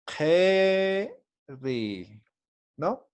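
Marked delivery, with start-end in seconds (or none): 0.1-1.1 "Keri" with a long, rising "Ke", pause, then "ri"; syllable-by-syllable pronunciation. Shown as a demonstration. Teacher breaking the word down for students, "Keri" drawn out by syllable. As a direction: stretch and split "Keri" into two syllables. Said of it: "Entonces, la pronunciación".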